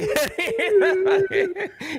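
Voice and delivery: long high cackle of laughter; high laughing fit. Real, not acted. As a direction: break down laughing. End of the prank story.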